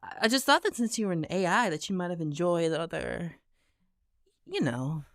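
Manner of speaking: small, awkward gamer voice; hesitant young gamer. Acted. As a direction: tread carefully, searching for the word.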